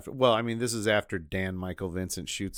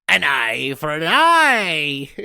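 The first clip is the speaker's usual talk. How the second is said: slow, loud gloating line; mock leprechaun pun; 5 semitones higher, 10 dB louder, much slower than usual. Cohost quoting the leprechaun's eyeball pun, slow gloating. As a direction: savour the pun like a villain.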